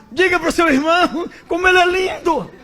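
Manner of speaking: high, loud preacher shout to the crowd; preacher's shout. Retold or imitated. Pentecostal preacher urging the congregation, high loud shout.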